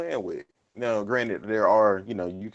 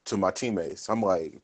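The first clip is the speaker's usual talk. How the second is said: ordinary delivery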